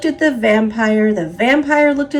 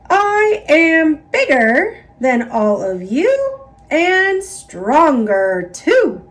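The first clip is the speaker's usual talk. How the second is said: boastful; 6 semitones higher, 4 dB louder than usual. A narrator's reading. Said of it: "boasted the mummy".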